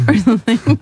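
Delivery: usual speaking voice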